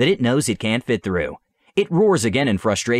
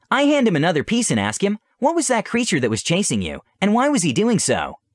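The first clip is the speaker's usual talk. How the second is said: put-on higher young princess voice; 8 semitones higher than usual. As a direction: voice the princess, gentle but eager. Solana asks the creature.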